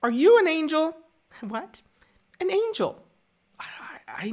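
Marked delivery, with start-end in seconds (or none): 0.0-0.9 put-on high, innocent child's voice; put-on high kiddie question; young Anakin. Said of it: "the one from Anakin in Phantom Menace". 1.4-1.8 soft, high, puzzled question; small high-pitched query; feminine reply. Acted. Padme replies to young Anakin. 2.4-3.0 put-on high, innocent child's voice; put-on high kiddie question; young Anakin. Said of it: "the one from Anakin in Phantom Menace".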